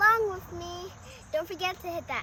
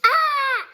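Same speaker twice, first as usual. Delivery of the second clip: surprised cry; 8 semitones higher, 7 dB louder than usual. Acted. Larry reacts to turning yellow.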